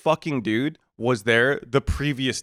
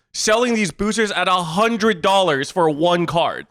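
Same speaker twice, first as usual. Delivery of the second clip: raised, emphatic accusatory rant; retold angry confrontation; 5 semitones higher than usual. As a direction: lay out the accusation with mounting exasperation. Retold angry confrontation with shop owner.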